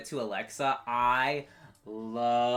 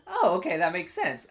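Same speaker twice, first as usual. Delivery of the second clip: high, louder "oh" settling into understanding; sudden realization; 5 semitones higher, 5 dB louder than usual. Retold or imitated. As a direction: act out the moment it clicks. Acts out own past realization.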